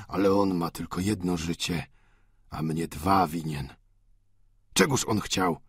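calmly. Acted. Goriot's calm threat continues.

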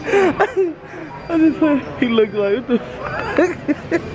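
high, very slow words stretched by laughter; laughing through words. Real, not acted. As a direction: talk through the giggles. Laughing while retelling.

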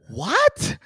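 high, loud incredulous cry; outraged disbelief. Retold or imitated. Husband reacting to the doctor's eye roll, outraged disbelief. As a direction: blurt it out in acted outrage.

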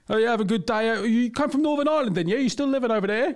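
very high, chatty fake English accent; nosy English small-talk voice. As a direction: keep the stranger act going in an English accent. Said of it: "convince my dad I was English".

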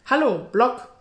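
loud, high, merry greeting; cheerful call. Acted. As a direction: call out brightly to the servant. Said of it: "rief Peter vergnügt".

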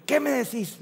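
high-pitched mock scolding; reto imitado de mamá. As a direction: imitate a wife demanding an answer. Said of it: "Ella grita". (imitando un reto, con voz aguda)

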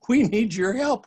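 high, loud eager plea; a young hero calling for help; cry for help. A narrator's reading. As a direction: call out urgently for help. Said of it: "cries Miles".

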